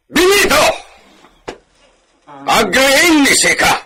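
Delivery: high, loud call across the tavern; bellowing at a sleeping friend; shouting. Acted. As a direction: yell to wake him up. Drunk man calling from table.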